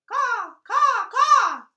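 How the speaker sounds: loud, high crow caws; shrill cawing crow; croaking crows. Acted. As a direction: caw like a harsh crow. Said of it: "croaked the crows".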